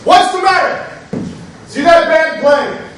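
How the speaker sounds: triumphant shout. Acted. Triumphant new landlord taunting.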